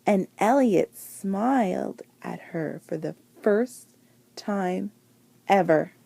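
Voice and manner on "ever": ordinary delivery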